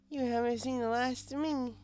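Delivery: high tipsy parting shot; high drunk mare's cheeky goodbye; laughing drunk. Acted. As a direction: tease on the way out. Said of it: "She laughed".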